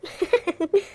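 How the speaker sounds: sly little laugh; gloating chuckle. Acted. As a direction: chuckle to yourself over his misery. Gloats over the son's regret.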